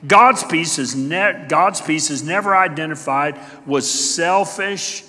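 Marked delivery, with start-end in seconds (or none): none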